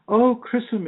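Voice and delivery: a high, plaintive Irish accent imitating Amelia. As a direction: mimic Amelia's Irish lilt, lifted high. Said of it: "in that beautiful Irish accent she has".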